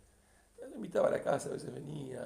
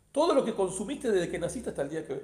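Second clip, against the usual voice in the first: louder, higher imposing demand; retold stern demand; 4 semitones higher, 10 dB louder than usual. Father setting terms to his banished son, retold stern demand. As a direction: push the demand out forcefully.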